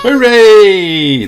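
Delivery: high, loud happy cheer. A narrator's reading. Children cheering the magician. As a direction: cheer like a crowd of kids. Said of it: "The audience cheered".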